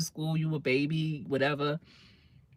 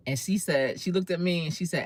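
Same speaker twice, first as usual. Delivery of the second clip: ordinary delivery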